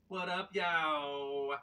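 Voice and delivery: loud, slow hyped shout-out; hype greeting. Acted. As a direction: stretch it out like a hype man.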